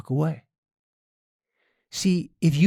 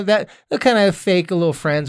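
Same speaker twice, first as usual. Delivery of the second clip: usual speaking voice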